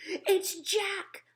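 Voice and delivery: high, loud cry of terror; panicked bellow. A narrator's reading. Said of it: "bellowed the flesh lump eater".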